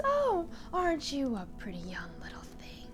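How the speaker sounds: eerie whisper right in her face; whispering banshee. Retold or imitated. A banshee creeping up to Odette's face, eerie whisper. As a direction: hiss sweetly like a ghost.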